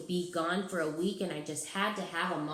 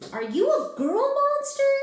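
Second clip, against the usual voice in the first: put-on surprised kid; 10 dB louder than usual. Kid shocked question.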